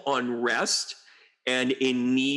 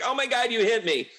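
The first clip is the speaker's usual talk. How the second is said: higher whiny mock victim; mock narcissist; 10 semitones higher than usual. Mocking a narcissist who cries foul, higher whine. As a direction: play the fake victim, higher. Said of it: "they're like".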